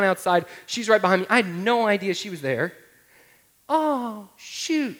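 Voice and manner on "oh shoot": put-on high little child's voice; lightly voiced small daughter; toddler voice. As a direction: echo it like a parroting two-year-old. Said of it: "She says it again".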